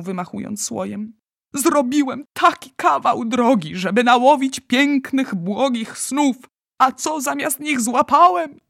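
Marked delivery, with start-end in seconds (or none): 1.5-8.6 high, loud lament; despairing. A narrator's reading. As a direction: bewail the wasted journey. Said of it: "popadał w coraz czarniejszą rozpacz".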